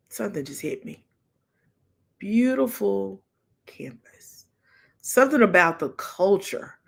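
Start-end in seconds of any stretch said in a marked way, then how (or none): 2.2-4.5 breathless whispered awe; awed whisper. Real, not acted. Mother remembering the campus, whispered in awe. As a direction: breathe the word in wonder.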